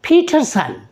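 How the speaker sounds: पीटरसन with puffs of air on P and T; teacher's model of पीटरसन with aspirated consonants. Shown as a demonstration. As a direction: say पीटरसन as "phee-thur-sun". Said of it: "पी को फ की आवाज़ से".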